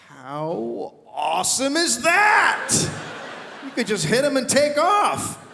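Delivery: high, slow shouted delight; high stretched-out gleeful shout; mock glee. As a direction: gloat loudly and slowly. Gleeful about hitting biker.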